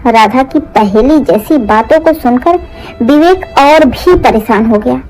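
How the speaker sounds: ordinary delivery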